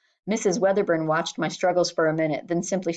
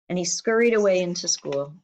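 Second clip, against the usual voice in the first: plain reading voice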